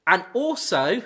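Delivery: normal talking voice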